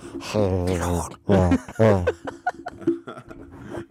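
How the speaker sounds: loud laugh spat into the microphone; a loud laugh right into the microphone; mic-eating laugh. Real, not acted. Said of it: "Oh, that hurt".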